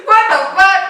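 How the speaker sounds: high shrieking laugh; burst of laughter. Real, not acted. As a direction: crack up loudly. Sibling says something funny.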